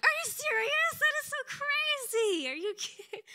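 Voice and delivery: high, loud, squealed astonishment; thrilled disbelief. Retold or imitated. Wife reacting to husband's cool God moment, thrilled disbelief. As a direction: imitate yourself flipping out with excitement.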